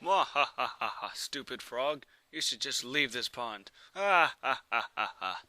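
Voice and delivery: hammy villain laugh and sneer; cackling cartoon villain taunt. Villain catfish taunts frog.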